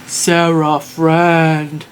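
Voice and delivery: a hint of a slow, dim giant; lightly voiced big hairy beast; hairy monster voice. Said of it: "it's a big hairy guy".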